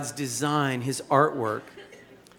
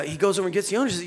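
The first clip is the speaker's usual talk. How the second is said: ordinary talk, nothing marked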